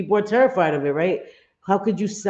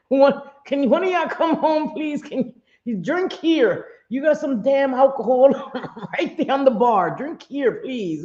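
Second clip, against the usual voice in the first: put-on higher whiny plea to the kids; mock pleading; 7 semitones higher than usual. Mom jokingly begging her sons to come home, whiny high plea. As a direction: beg like a scared kid, pitched up.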